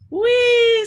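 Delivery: high, loud cry of joy; gleeful whee. A narrator's reading. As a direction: shout it like a thrilled ride. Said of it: "says the dragon".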